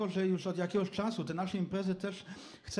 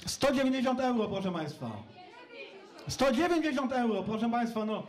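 raised voice calling out bids; auctioneer; 4 semitones higher than usual. Real, not acted. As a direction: call the prices out to the room.

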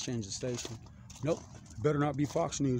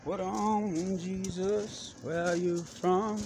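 high, slow, puzzled mock voice; dude's voice; 6 semitones higher, much slower than usual. Guy in the Northwest calling out, high and slow. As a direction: stretch it out, baffled and high. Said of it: "I hear some dude like".

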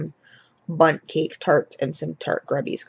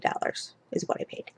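whispered; 10 dB quieter than usual. Real, not acted. Confiding the low price.

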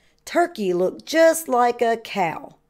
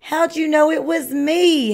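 high, lightly acted howl; a mild storybook howl from a found-out turkey; 6 semitones higher, 4 dB louder than usual. A narrator's reading. Turkey, unmasked by Rooster, asks with a mild high howl. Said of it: "howled Turkey".

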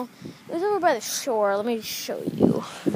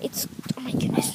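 scared whisper; 5 dB quieter than usual. Real, not acted. Hiding from groundhog.

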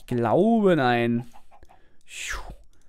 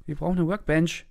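ordinary talk, nothing marked

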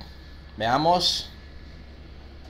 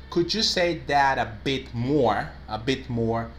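slow model sentence; much slower than usual. Shown as a demonstration. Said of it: "So the sentence will be".

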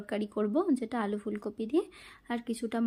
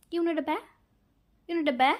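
higher, louder mock British cashier; 5 semitones higher, 5 dB louder, slower than usual. Imitating a supermarket cashier asking about bags, mock British. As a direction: ask You need a bag like a British checkout clerk. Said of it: "এরা বলে যে".

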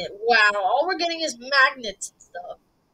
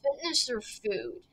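ordinary talk

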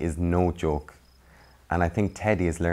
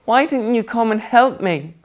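sobbing; 9 dB louder than usual. Acted. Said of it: "sobbed Millie".

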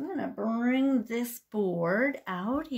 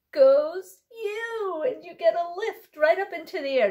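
higher, louder, with a whoop; excited lift; 6 semitones higher, 5 dB louder than usual. Shown as a demonstration. Librarian lifting baby in the air, excited whoop.

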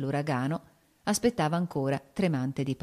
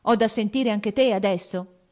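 1 near shout; 4 semitones higher, 5 dB louder than usual. A narrator's reading. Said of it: "gridò quasi egli".

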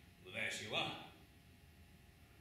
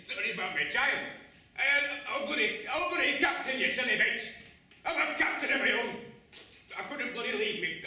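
much higher and louder desperate yelling; furious shout; 10 semitones higher, 12 dB louder than usual. Acted. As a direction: bellow it out, torn between lass and crew. Captain yells at the lass.

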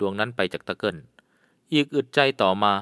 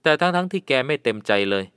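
ordinary delivery, nothing marked

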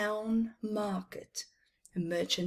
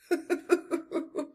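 camel chuckle; 10 semitones higher than usual. A narrator's reading. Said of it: "The other camels chuckled".

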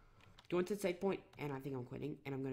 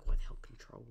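retold under-the-breath whisper; mock whisper of frustration; whispered curse; 3 semitones lower, 5 dB louder than usual. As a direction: hiss it under your breath. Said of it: "I was like".

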